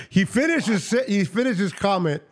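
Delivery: high-pitched, cracking up in amazement; laughing. Real, not acted. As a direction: talk through helpless laughter. Cracking up at co-host's calm request.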